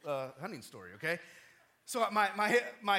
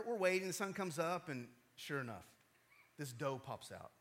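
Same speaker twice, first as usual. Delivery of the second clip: ordinary talk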